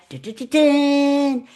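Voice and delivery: sung high and loud like a drama sting; dramatic sting. Shown as a demonstration. Teacher showing how a pause creates drama, loud sung sting. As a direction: belt a suspense sound effect. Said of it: "It creates drama".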